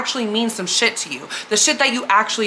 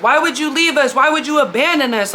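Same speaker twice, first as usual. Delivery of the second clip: higher, mildly mock-frustrated plea; retold frustrated scream; 5 semitones higher than usual. Disciple calling to Jesus from the boat, retold frustrated outcry. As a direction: act out his complaint without really screaming. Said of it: "screaming at Jesus from that far".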